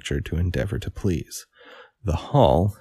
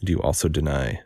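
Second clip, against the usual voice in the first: ordinary delivery, nothing marked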